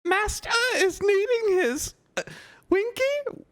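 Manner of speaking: very high, trembling elf whimper; whimpering house-elf. Acted. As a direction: whimper it like a lost little elf. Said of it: "whimpered the elf".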